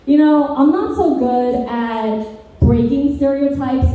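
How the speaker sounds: usual speaking voice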